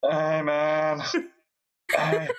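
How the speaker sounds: drawn-out, dazed stoner voice; stoned and dragging out the words; stoner guy. Pretend. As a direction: play a spaced-out stoner. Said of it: "Oh God, the Stone Guy's back".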